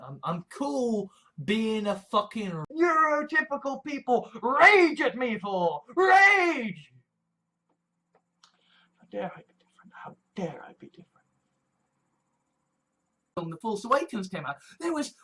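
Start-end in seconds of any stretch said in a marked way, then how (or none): 4.4-6.9 slow, drawn-out yell of fury; hammed-up raging shout, stretching the words; mock rage. Acting out rage at the listener. 9.0-11.1 very soft, low near-whisper; mock-scandalized murmur, barely voiced; hushed mock indignation. Hushed aside after the mock rage.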